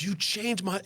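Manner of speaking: high-pitched retold outburst, broken off; lightly acted emotional quote; imitated tearful gratitude. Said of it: "starts hysterically crying. Like a baby".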